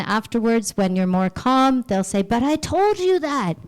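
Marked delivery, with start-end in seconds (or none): 2.2-3.6 higher, put-out protest; mildly exasperated other person; annoyed higher voice. Retold or imitated. Acted quote from the other person.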